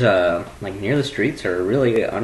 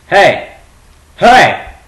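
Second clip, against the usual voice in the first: loud, high sharp shout; 8 semitones higher, 10 dB louder than usual. Real, not acted. Shouting at the cat Bandit. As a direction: yell hey at the cat.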